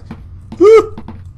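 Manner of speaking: high sharp frightened gasp; startled gasp. Real, not acted. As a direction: gasp as the monster appears. Monster suddenly outside.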